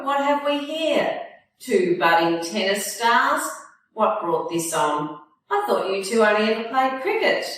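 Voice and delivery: put-on a jolly grandfather ribbing the boys; cheerful grandfather. He called out to them.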